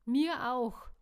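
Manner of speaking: a stifled chuckle behind the words; chuckling. A narrator's reading. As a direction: gulp back a laugh. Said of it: "gluckste Caro".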